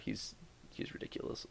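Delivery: ordinary delivery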